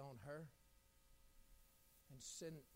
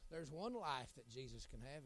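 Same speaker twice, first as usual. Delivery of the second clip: normal talking voice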